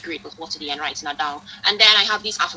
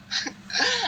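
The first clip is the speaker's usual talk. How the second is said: high startled laugh. Real, not acted. Seeing the moon in room.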